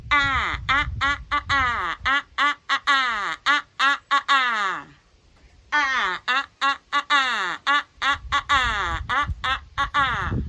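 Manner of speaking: loud clear repeated "ah" like the a in cat; short a drill. Shown as a demonstration. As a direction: drill "ah" crisply, many times.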